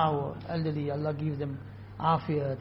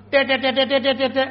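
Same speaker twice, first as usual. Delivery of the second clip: high, loud panicked parrot squawk; 8 semitones higher, 9 dB louder than usual. Retold or imitated. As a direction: squeal like a caught parrot. Parrot squawk when grabbed.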